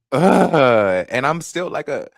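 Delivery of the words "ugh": loud, raised "ugh"; exasperated groan. Real, not acted. Embarrassed at still acting young, loud exasperated groan.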